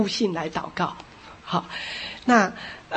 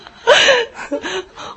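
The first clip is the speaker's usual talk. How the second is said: laughing almost to tears; high helpless laugh; 5 dB louder than usual. Real, not acted. Pastor laughing at his own failed attempt, near tears. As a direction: laugh at your own failure.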